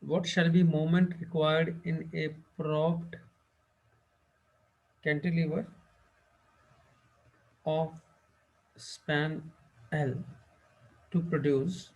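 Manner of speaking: slow dictation, each word spaced apart; dictating slowly. Acted. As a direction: read the question word by word for notes. Dictates exam question slowly. (प्रश्न धीरे-धीरे लिखवाना)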